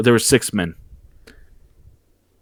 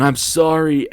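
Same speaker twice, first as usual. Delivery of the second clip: raised, higher-pitched cry; imitated yell; 6 semitones higher than usual. John Cena yelling as he chokes out Punk, higher mock yell. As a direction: shorter mock yell of apology.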